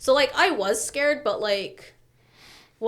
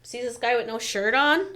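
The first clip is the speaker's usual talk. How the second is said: ordinary talk, nothing marked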